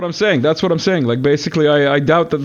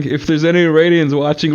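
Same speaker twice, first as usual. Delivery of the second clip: normal talking voice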